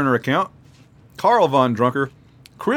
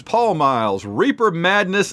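ordinary delivery

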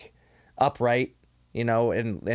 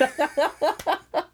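high burst of laughter. Real, not acted. Laughing at own Kano quote.

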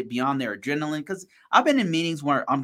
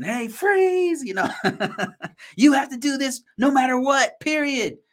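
high, loud barking cop order, laughing through it; mock cop; 13 semitones higher, 4 dB louder than usual. Mocking cops shouting at a user, high and loud. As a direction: ham up a cop busting in. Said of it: "kind of, like, playing the cops".

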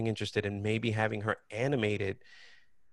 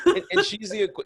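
laughing; 12 semitones higher, 8 dB louder than usual. Real, not acted. Laughing after the voice bit.

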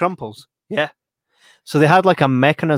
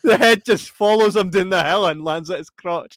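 normal talking voice

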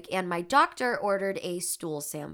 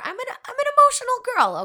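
mock whine; 9 dB louder, much faster than usual. Self-mocking about crying often.

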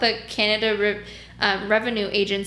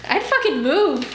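escalating comedy rant; 2 semitones higher than usual. Real, not acted. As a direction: rant in growing disbelief. Escalating outrage at clients.

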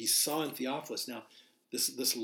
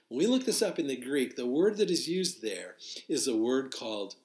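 usual speaking voice

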